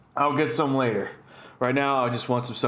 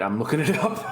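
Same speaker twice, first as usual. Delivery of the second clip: laughing admission; 9 semitones higher than usual. Real, not acted. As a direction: break into a high, wheezy laugh. Admits he is unsure, laughing.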